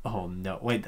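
usual speaking voice

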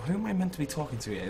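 ordinary talk

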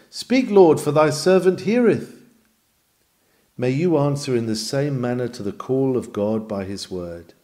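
usual speaking voice

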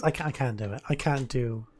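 ordinary talk

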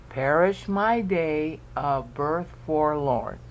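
slow, syllable-by-syllable reading; counting out syllables. Shown as a demonstration. Teacher repeats verse for the count, slow and measured. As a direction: space out every syllable for counting. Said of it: "Count out the syllables".

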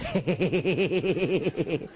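goat-like bleating cackle; bleating laugh. Retold or imitated. Comedian mimics Mario Barth's bleating laugh. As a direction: bleat out a mocking stuttered laugh. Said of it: "wenn ich dieses Geräusch schon höre".

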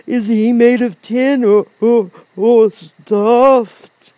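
loud, high, halting question; Cowardly Lion, bewildered. A narrator's reading. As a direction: stumble slowly through the question as a timid lion. Lion asks.

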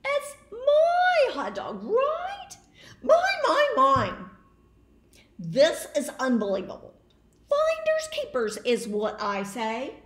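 high, loud shouting tantrum; furious pigeon. Acted. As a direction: stamp your feet with the voice. Pigeon yells and hoards.

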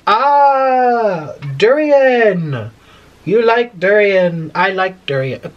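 slow, drawn-out Thai-accented imitation; imitated Thai accent. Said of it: "before you say I'm mimicking his accent".

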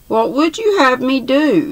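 high, pleading cry of despair; helpless desperation. A narrator's reading. The old man answering the dwarf's sneer, desperate. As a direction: throw up your hands, no way out. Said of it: "in a kind of helpless desperation".

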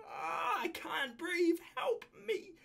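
high, drawn-out wail of the lyrics; slow, high fake cry for help; hammed-up breathless pleading; mock plea. Acts out the lyrics again.

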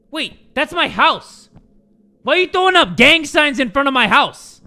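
high, loud protest at an intruder; indignant outburst. Pretend. Confronts game character outside their house.

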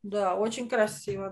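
ordinary delivery

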